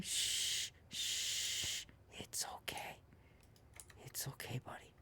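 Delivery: soothing whisper to an animal. Real, not acted. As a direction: hush it gently in a whisper. Whispering to calm the boar.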